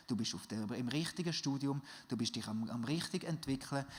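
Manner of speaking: leiser, tiefer, beruhigender Zuspruch; tröste die Zuhörer leise; sanft zusprechend. Acted. Reassures the listeners softly. (leise und beruhigend, der Prediger ermutigt die Gemeinde)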